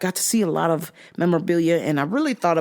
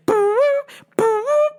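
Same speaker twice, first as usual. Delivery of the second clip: mouthed beat pitched way up and louder; high loud boom; 4 dB louder than usual. Retold or imitated. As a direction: punch out the high hit of the track. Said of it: "Like when they play".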